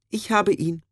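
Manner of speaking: high, mild character voice; high meek voice. A narrator's reading. Nutt speaks.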